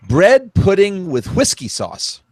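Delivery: usual speaking voice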